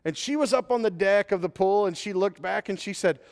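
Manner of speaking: usual speaking voice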